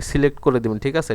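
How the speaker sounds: usual speaking voice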